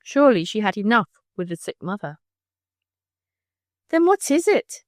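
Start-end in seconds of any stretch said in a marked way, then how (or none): 3.9-4.8 higher, pressing question; a demanding, higher-pitched question. A narrator's reading. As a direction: insist on an answer. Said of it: "demanded Tavia".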